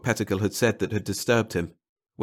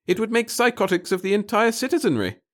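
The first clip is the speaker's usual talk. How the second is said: high, nervous little official; a fussy, timid bureaucrat; 13 semitones higher, 5 dB louder than usual. Acted. Pettigill horrified.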